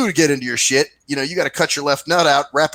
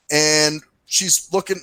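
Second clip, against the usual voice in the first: ordinary talk, nothing marked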